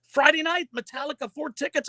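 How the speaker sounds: high, eager rush of news; excited news. Retold or imitated. Husband telling his wife about Metallica tickets, excitedly high. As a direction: burst in with the big news.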